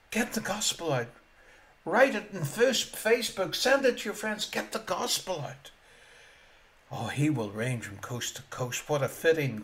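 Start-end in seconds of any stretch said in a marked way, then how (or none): none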